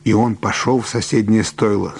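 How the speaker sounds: ordinary delivery, nothing marked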